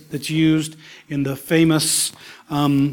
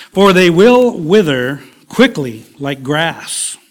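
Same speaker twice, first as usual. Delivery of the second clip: slow, louder reading of the verse; slow scripture reading; 7 dB louder, slower than usual. Acted. Preacher reading the psalm to his congregation, slow and weighty. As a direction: draw out each word like a preacher.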